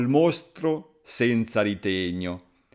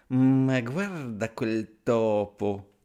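slow, sly snake voice savouring the prey; a snake sizing up dinner, slow and sly; much slower than usual. Acted. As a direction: drawl it out like a hungry snake. Snake eyeing mouse as dinner.

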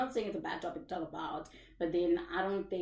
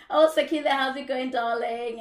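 higher and louder, calling out a welcome; excited greeting; 7 semitones higher, 11 dB louder than usual. Real, not acted. Host greeting a viewer in the live chat, loud and bright.

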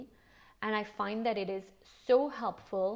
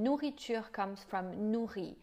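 ordinary talk, nothing marked

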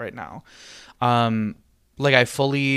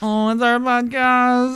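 high, drawn-out sing-song voice; 11 semitones higher, 4 dB louder, slower than usual. Acted. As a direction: stretch it out up high, playful. Sung-out high mock line.

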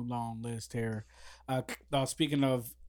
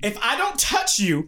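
emphatic; 7 semitones higher, 7 dB louder, slower than usual. Real, not acted. Heated argument, repeated point.

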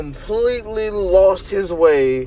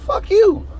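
high mocking brush-off; mock dismissive Hulk; 4 dB louder than usual. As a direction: toss it off like a jerk Hulk. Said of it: "leaves you like".

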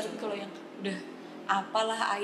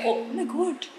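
higher British-accented oh; British exclamation; 6 semitones higher, much slower than usual. Shown as a demonstration. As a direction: show off a British "oh". (seruan "oh" logat British)

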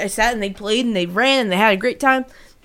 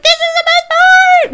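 put-on high, loud child's squeal; excited kid; 7 dB louder than usual. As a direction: squeal like a thrilled little girl. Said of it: "And Gracie was like".

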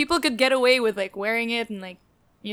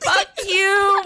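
playful yell; 8 semitones higher than usual. Real, not acted. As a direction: yell it back, laughing. Teased about gaming, playful outburst.

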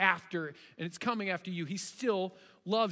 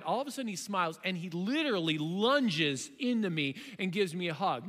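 normal talking voice

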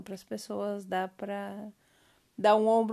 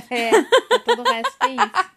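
laughing; 11 semitones higher, 13 dB louder than usual. Real, not acted. Laughing at cohost's joke.

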